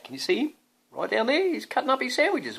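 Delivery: look, right there; excited pointing. Real, not acted. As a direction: point it out with delight. Pointing out picture to child.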